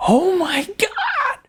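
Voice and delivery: high, slow excited shriek; stunned joyful shriek. A narrator's reading. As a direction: shriek it in disbelief. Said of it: "Tracy shriek".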